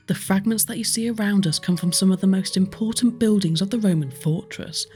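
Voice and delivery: normal talking voice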